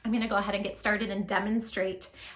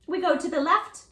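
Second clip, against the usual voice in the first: excited; 8 semitones higher, 4 dB louder than usual. Shown as a demonstration. Dance part of the story, read to children.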